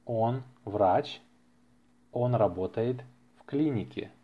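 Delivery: ordinary talk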